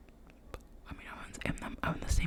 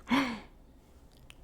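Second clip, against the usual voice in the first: excited gasp; 5 semitones higher, 7 dB louder than usual. Retold or imitated. Spots her old teacher walking by.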